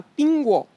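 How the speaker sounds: "pingguo" said as ping-gwo, the falling tone clear; Chinese word demo. Shown as a demonstration. Guest teaching the audience the word "pingguo", falling at the end. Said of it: "le mot pomme".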